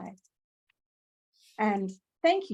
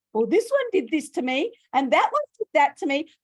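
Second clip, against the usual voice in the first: a hint of a complaining inner voice, higher and louder; inner grumbling voice; 6 semitones higher, 9 dB louder than usual. Guest voicing listeners' inner resentment, light mock complaint. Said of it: "this little voice goes".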